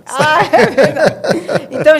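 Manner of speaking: loud embarrassed laugh through the words. Real, not acted. Host corrected by the guest on air, laughing it off. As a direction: laugh off the slip and start over.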